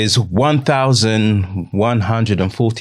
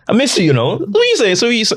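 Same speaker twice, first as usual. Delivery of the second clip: higher teasing son voice; playful son fishing for attention, pitched up; imitating his son; 12 semitones higher, faster than usual. Son teasing his dad at lunch, playful higher voice. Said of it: "be like".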